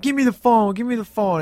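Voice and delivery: high, mildly mock-frantic demand; urgent demand. Marcus wanting the phone from Mike, retold urgent demand.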